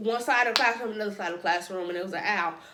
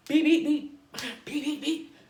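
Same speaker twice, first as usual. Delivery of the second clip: mock beeping; 4 semitones higher than usual. Imitated alarm noise.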